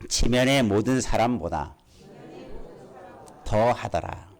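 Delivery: measured phrases with long pauses between; repeat-after-me reading. Shown as a demonstration. Preacher leading congregation in a verse, repeat-after-me reading. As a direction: read it out phrase by phrase, waiting for the echo. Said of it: "한번 따라할까요?".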